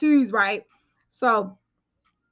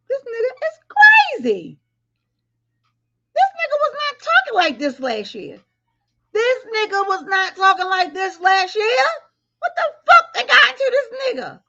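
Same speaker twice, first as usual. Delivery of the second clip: high, loud hammed-up wail; fake-cry in disbelief; mock wailing; 12 semitones higher, 5 dB louder than usual. Mourning how he talks now with a mock high wail. Mock crying over his change.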